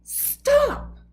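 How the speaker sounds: high, loud yell; hollering. A narrator's reading. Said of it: "when Moose hollered".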